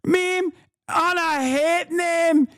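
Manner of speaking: high, slow Scottish parody of the clip; mock Scottish accent. Streamer mimicking the clip just played, high Scottish parody. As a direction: echo her high and drawn out in mock Scottish. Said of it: "Clip of her saying it".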